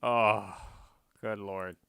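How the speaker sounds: normal talking voice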